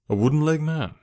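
lightly astonished; bland surprise. A narrator's reading. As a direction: feign casual surprise. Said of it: "with bland surprise".

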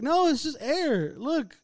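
put-on high, slow, drawn-out explaining to a kid; coaxing reassurance. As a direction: patiently coax a scared child, retold. Showing frightened child the dryer.

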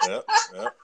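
loud high laugh into yep; laughing hard. Real, not acted. Friend laughing at the mad-because-you're-mad story, laughing yep. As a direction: laugh and agree through it.

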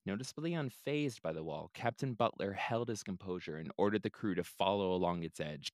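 plain reading voice

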